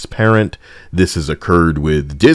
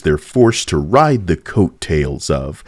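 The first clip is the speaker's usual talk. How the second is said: ordinary talk, nothing marked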